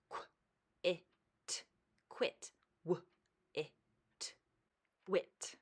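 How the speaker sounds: quick review sounding out each word; sounding out letters. Shown as a demonstration. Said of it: "Okay, let's review".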